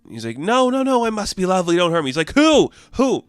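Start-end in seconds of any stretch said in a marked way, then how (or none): 0.4-2.1 high, comic begging for mercy; hammed-up frightened protest; panicked pleading. Threatened god begs her. 2.3-3.2 high, sharp repeated question; mock interrogation, pitched up; insistent demand. Demands a name from him.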